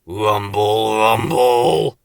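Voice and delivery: deep rumble swelling on the repeat; tummy rumble. A narrator's reading. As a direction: imitate a growling stomach. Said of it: "went Slow Coach's tummy".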